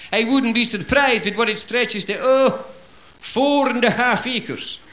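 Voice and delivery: small Highlander inflating his tiny croft. Acted. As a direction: boast with mock grandeur. Small Highlander boasting of ranch.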